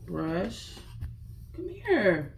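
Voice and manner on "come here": coaxing. Real, not acted. Coaxing the dog.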